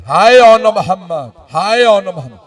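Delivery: brief acted cry of grief, falling away; understated mother's keening; mourning lament. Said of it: "गिरिया कर रही थी".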